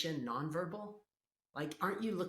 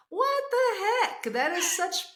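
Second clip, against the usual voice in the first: much higher, louder, laughing disbelief; laughing outburst; 11 dB louder, slower than usual. Real, not acted. Incredulous outburst at rhyme.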